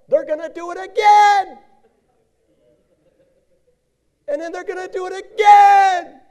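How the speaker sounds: high, loud, fed-up outburst; exasperated shout. Acted. Preacher shouting to the congregation about repeat offenders. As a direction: bellow it like you can't believe it.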